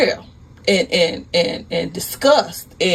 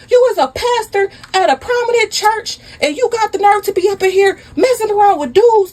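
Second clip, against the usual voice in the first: high-pitched outraged tirade; furious yelling; 13 semitones higher than usual. Acted. Wife fighting her cheating pastor husband, furious yelling. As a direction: yell high and hard, barely stopping.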